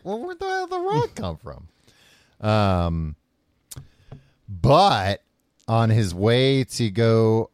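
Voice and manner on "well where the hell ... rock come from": high squeaky disbelief; incredulous. Retold or imitated. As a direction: ask it in shrill disbelief. Incredulous mock question.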